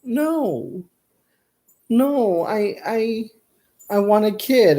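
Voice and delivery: put-on high whining plea, like a kid begging; mock-whiny childish no. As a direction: beg like a toddler who wants a toy. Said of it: "I was like".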